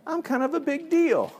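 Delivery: put-on very high kid voice; children feeling important. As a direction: mimic a child chuffed with themselves. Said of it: "children are like".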